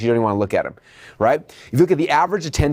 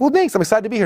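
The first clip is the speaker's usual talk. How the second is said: happy customer; 9 semitones higher, faster than usual. Acted. Customer answering salesperson.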